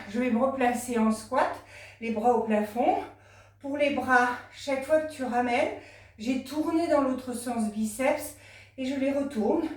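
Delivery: ordinary delivery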